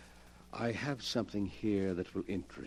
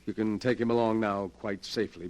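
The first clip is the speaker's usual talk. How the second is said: normal talking voice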